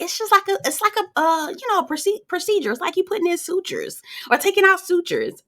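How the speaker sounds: high-pitched, chatty imitation of LaToya explaining; imitating LaToya. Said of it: "And she was like".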